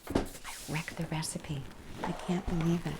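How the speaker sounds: real whispered admission to a helper; whispered aside. Real, not acted. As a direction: hush it off-mic. Aside after the show ends.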